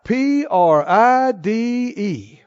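"P R I D E" spelled letter by letter, slowly; spelling out pride. Shown as a demonstration. Preacher spelling out the word pride, letter by letter. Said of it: "The problem is pride".

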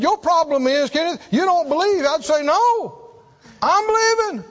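high, loud pleading cry to God. Retold or imitated. Paralyzed boy crying out to God in desperation. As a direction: plead with God at the top of your voice.

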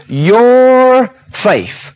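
"Your" drawn out long and held high; stress on Your. Acted. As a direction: stretch "Your" right out, then drop onto "faith". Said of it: "that's not what he emphasized".

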